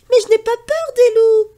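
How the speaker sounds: put-on high, loud boyish boast; a brave little boy shouting back; defiant boy. Pierre defies grandfather about wolves, high and loud.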